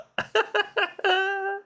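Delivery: high, drawn-out giggle; real laughing fit. Real, not acted. Gamer reacting to a dirty trick in the game, high giggling. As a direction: burst out in high helpless giggles. Amused by the game's joke.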